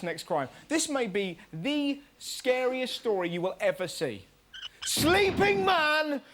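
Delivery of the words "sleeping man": slow outraged headline shout; shocked outcry. Real, not acted. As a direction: gasp it out in horror.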